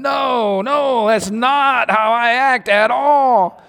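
high, long-drawn protest; God objecting. Acted. God correcting an angry father, drawn-out objection. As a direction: stretch out each denial, imitating a dismayed God. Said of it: "And God's going".

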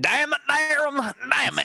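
high drawn-out damn it; exasperated groan. Real, not acted. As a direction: wail it out slowly after a bad roll. Failed roll, frustrated cry.

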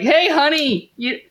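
high-pitched put-on parent voice; mock sweet parent. Parents slipping out on their son, high mock-sweet voice. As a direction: coo it like a parent making a casual excuse.

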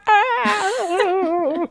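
long, high mock wail; hammed-up cry at being poked; pained scream. Suspect as his wrist gets poked, high pained wail. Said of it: "pokes some part of it".

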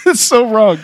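high-pitched, helpless with laughter; laughing. Real, not acted. Priest cracking up at the wildly wrong thermometer, laughing. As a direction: barely get it out for laughing. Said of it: "that's why I'm laughing".

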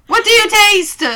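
loud high snooty taster voice; mock wine critic. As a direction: play a pompous beer judge. Mock pretentious beer snob question.

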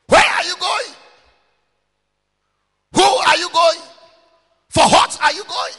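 high, loud challenging questions; preaching shout. Real, not acted. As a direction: demand an answer from the crowd.

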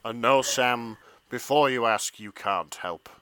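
put-on low, deep, moody teen voice; Nick's voice. Nick reads his lines, low and brooding. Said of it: "over to you".

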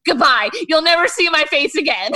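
loud, high melodramatic exit; mock dramatic farewell. Imitating her running away in Mexico, loud mock farewell. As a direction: call it out as a hammed-up goodbye.

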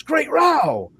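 high, booming cry of astonishment; hammed-up superhero outcry; shouted exclamation. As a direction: belt it out as a comic-book hero's exclamation.

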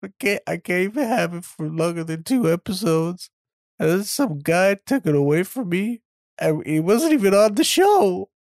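high, whiny crybaby complaint; mock crying. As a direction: bawl it out, hammed up and self-pitying. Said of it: "[name]'s crying".

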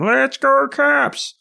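high-pitched stretched-out fan holler; mock bellow from the stands; bellowing fan yell. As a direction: imitate a loudmouth calling over the whole arena. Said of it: "bellowing voice".

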